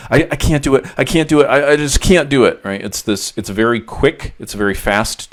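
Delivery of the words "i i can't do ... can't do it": high, tense, labored self-talk; anxious inner voice. Shown as a demonstration. Therapist demonstrating a client's anxious inner voice, tense and high. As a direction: act out the panicky voice in your head. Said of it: "It's a very sort of labored, tense tone".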